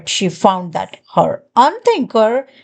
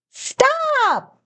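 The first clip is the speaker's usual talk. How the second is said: a sharp, high cry of Stop; yelled; 13 semitones higher, 3 dB quieter than usual. A narrator's reading. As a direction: cut him off with a high yelp. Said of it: "my sister yelled".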